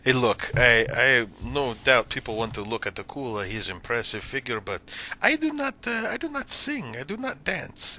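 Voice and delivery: character voice climbing high in protest; proud fighter Akula. Acted. Fighter refuses to sing or dance on stage, indignant protest. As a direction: start firm, then turn high and indignant.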